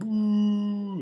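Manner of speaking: long, high, loud booing; imitated crowd boo. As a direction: boo like a whole jeering audience. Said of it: "So people was like".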